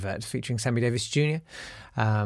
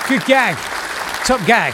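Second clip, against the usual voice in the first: loud praise; 8 semitones higher, 6 dB louder than usual. Real, not acted. Loud praise after the punchline.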